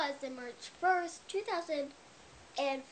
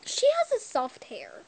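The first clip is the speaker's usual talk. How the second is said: excited; 11 semitones higher, 6 dB louder than usual. Real, not acted. Excitedly showing the doll's hair.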